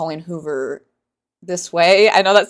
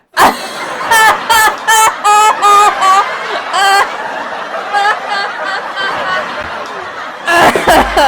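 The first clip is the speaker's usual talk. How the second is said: long, high, loud cackle; uncontrolled laughing; 8 dB louder than usual. Real, not acted. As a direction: laugh helplessly until it trails away. Cracks up at own joke.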